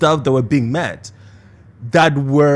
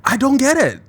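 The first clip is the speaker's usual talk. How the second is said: baffled; 9 semitones higher, 3 dB louder than usual. Real, not acted. Baffled at friends' choices.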